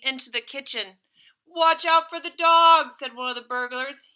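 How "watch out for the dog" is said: higher, much louder urgent call; loud warning. Acted. Burglar warning partner about the dog, loud call. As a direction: shout the warning to your partner.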